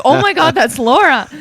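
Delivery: loud, high shocked gasp. Retold or imitated. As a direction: blurt it out in disbelief. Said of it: "And I was like".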